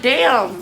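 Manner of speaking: loud pained damn; pained groan. Retold or imitated. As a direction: groan it out in pain. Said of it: "I'm making, like, pain noises".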